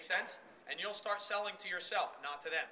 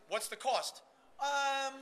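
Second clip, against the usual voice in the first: a wavering "Um" that goes up high; hesitant stalling; 6 semitones higher, 4 dB louder, slower than usual. Shown as a demonstration. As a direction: caught off guard, stall on the price. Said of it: "here's the second worst thing you can do".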